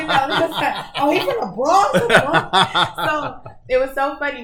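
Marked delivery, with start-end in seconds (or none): 0.0-3.0 high, loud, laughing disbelief; loud, drawn-out incredulous reaction; astonished students. Retold or imitated. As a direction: imitate students shouting in amused surprise. Voicing students' surprised reaction.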